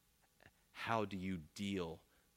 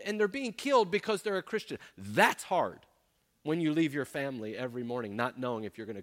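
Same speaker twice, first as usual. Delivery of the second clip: louder, higher, emphatic sermon rebuke; fiery preaching; 6 semitones higher, 6 dB louder than usual. Acted. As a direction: preach hard, driving each point home. Preaching at full force.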